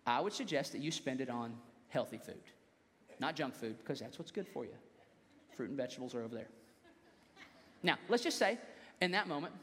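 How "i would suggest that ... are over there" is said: lower, softer fatherly advice; a gentle hint of a lecturing dad; father talking. Father advising his son on healthy food, softly and lower. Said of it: "this is your father talking".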